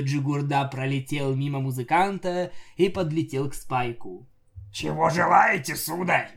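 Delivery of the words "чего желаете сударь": servile floating head. A narrator's reading.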